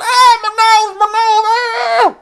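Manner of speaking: high, loud cry of pain; girl hit by a flying bottle; pained wail. Retold or imitated. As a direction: wail over a hit nose. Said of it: "and she's going".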